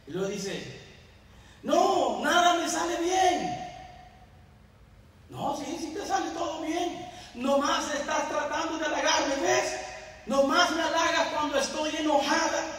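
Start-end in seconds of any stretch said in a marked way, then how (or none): 1.6-12.7 upset wife. Retold or imitated. Wife voiced, upset with husband.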